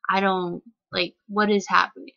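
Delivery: ordinary delivery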